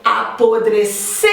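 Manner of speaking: Apodrecer with a long, forceful final cer; Apodrecer, leaning hard on its last syllable; stress on final syllable. Shown as a demonstration. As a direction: hit and stretch the cer at the end of Apodrecer. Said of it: "é a sílaba que eu falo com mais intensidade".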